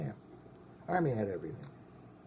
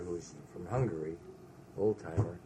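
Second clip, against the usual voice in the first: usual speaking voice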